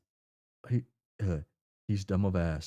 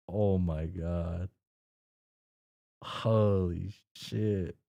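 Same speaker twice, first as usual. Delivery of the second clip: ordinary talk, nothing marked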